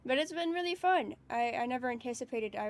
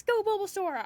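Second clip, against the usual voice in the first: enthusiastic cheer; 7 semitones higher, 3 dB louder than usual. Real, not acted. Streamer echoing a viewer's Bulbasaur cheer, enthusiastic.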